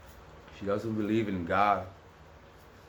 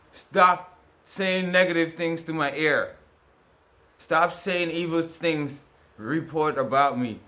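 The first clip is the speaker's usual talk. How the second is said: pleading demand; 6 semitones higher, 5 dB louder, slower than usual. Real, not acted. Demanding people stop harassing.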